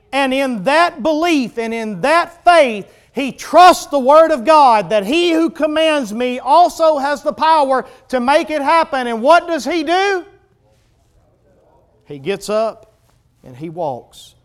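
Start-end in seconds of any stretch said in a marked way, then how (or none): 0.1-10.2 high, loud sermon crescendo; full-force preaching. Real, not acted. Preacher tells congregation of the lame man's faith, full-force preaching.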